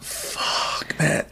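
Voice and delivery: whispered, retold dismay; hushed dismayed curse. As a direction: curse under your breath, reliving the moment. Dismayed at the botched shot, retold.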